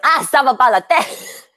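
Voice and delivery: high, indignant French outburst; acted road rage in French. Driver yelling in French, acted road rage. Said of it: "Sometimes I'll even do it in French".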